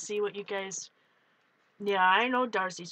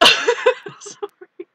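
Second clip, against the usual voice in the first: laughing; 10 semitones higher, 11 dB louder than usual. Real, not acted. Still laughing after spider.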